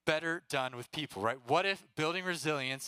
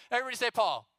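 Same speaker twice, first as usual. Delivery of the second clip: higher, energetic call to the crowd; rallying shout; 7 semitones higher, 3 dB louder, faster than usual. Real, not acted. Youth speaker retrying a weak audience response, rallying shout. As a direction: shout it out to get a bigger response.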